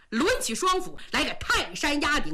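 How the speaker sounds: ordinary delivery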